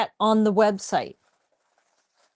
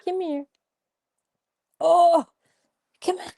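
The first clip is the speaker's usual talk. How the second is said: coaxing the dog; 13 semitones higher than usual. Real, not acted. Calling the dog over.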